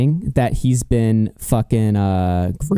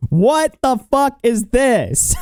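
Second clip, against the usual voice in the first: high, slow incredulous outburst; shocked disbelief; 11 semitones higher, slower than usual. Real, not acted. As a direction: react in stunned disgust. Shocked reaction to picture.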